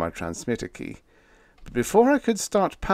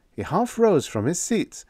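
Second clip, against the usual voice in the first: ordinary delivery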